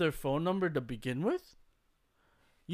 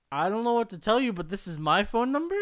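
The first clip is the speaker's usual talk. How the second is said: higher, louder, fast pleading; higher and louder protest, sped up; exasperated; 6 semitones higher, 6 dB louder than usual. Retold or imitated. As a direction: rush it out, at wit's end with the caller. Exasperated reply to angry caller.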